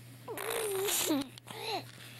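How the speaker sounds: high squealing laugh. Real, not acted. Laughing while pet is playful.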